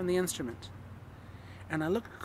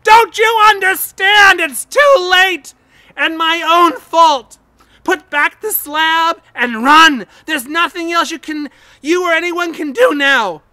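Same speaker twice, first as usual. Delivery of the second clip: very loud, high despairing scream; 10 semitones higher, 20 dB louder, slower than usual. A narrator's reading. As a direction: shriek it, all hope gone. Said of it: "a scream of utter despair".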